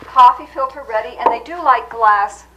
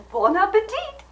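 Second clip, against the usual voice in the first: high, lilting "Bon appetit"; playful high-pitched sign-off; high French toast; 9 semitones higher than usual. Pretend. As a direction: send it up high, like a hostess's flourish. Comic host toasts in French.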